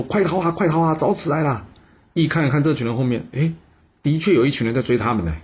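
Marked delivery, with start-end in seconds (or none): 0.0-1.7 shouting in panic. A narrator's reading. Fleeing villagers yell a warning while running, panicked shout. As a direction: cry out to everyone to flee. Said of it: "边跑边大声的喊说".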